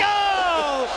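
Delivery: high, loud caster yell; excited shout. Real, not acted. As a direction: burst out at the play. Caster reacts to a double kill.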